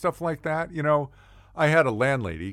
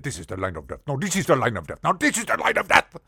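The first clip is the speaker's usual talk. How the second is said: Muammar Gaddafi; 3 semitones higher, 3 dB louder, faster than usual. Acted. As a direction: repeat it with rising, hammed-up insistence. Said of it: "He was the one that drew".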